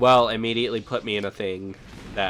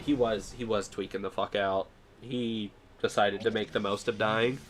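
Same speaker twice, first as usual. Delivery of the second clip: normal talking voice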